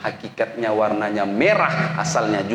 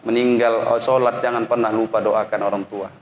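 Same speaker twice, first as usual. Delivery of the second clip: ordinary delivery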